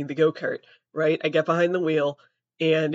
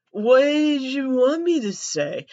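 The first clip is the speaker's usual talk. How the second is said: higher, louder and slow, exasperated; 8 semitones higher, 5 dB louder, slower than usual. Retold or imitated. Answering her angry dad, loud slow exasperation. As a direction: fling the question back, drawn out in disbelief.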